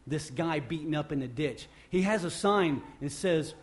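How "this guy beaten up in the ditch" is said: normal talking voice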